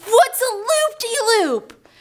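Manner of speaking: high, slow, drawn-out dread; alarmed question. Retold or imitated. Scared rider told about the next ride, high alarmed question. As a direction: stretch the question out in mock alarm.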